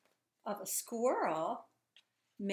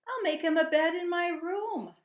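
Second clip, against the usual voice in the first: put-on excited girl; 10 semitones higher, 11 dB louder than usual. Girl's voice read aloud.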